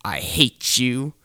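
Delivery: ordinary delivery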